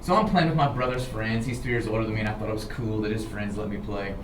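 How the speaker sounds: plain reading voice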